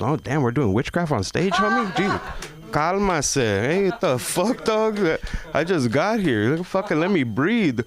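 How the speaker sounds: high, annoyed mock rant; exasperated retort. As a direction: push back at the crowd. Retorting to heckler in story.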